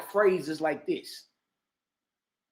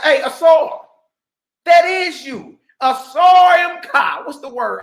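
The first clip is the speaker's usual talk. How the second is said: loud, higher mock street call; shouted greeting; 6 semitones higher, 9 dB louder than usual. Fans spotting Asar in public, shouting. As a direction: holler his name across the store. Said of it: "they can be like".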